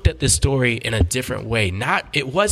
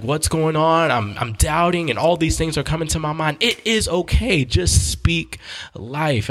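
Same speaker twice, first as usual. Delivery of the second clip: worked-up, higher-pitched encouragement; excited preaching; 7 semitones higher than usual. Real, not acted. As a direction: build into a preacher's exhortation. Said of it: "I'm about to preach".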